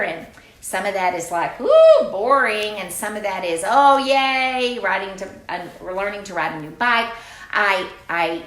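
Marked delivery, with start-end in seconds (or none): 1.6-2.8 loud, slow groan of boredom; mock bored kid. Teacher mimics children finding lockdown dull, loud mock groan. Voicing kids' reaction. 3.7-7.1 loud, bright cheer; mock kid excitement. Teacher mimics children excited about learning, loud cheer.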